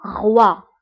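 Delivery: غوا said as French roi, uvular r; teacher modelling غوا as French roi; French word model. Shown as a demonstration. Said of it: "إكزامبل".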